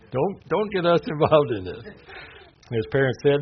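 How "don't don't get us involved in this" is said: higher, louder dodging plea; mock protest. Parents dodging the Pharisees' questions, mock protest. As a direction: beg off with a laugh breaking in.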